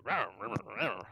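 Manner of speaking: mock goblin growls, fading; goblin speech. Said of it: "you guys just hear".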